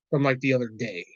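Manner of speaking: normal talking voice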